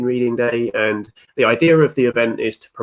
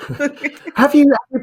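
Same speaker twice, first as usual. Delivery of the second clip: laughing; 6 dB louder, faster than usual. Real, not acted. Laughs while asking.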